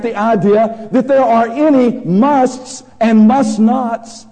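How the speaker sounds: loud, high sermon voice, pressing each phrase; full-force preaching. Acted. Preacher to congregation, loud fervent preaching. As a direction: thunder it from the pulpit.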